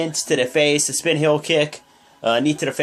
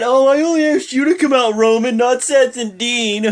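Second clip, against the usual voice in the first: put-on high, loud babyish whine; whining like a baby; 8 semitones higher, 5 dB louder than usual. Miz whining at Roman that only he was invited, babyish. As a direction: complain like a spoiled kid. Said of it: "Miz is whining like a little baby".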